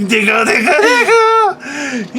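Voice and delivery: very high, slow, laughing puppy talk; cooing baby talk. Retold or imitated. Teasing shorter people like pets, high laughing baby talk. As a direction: tickle and coo at them like a little dog. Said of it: "I'm like".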